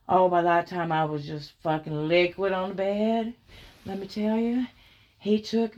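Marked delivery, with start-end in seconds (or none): none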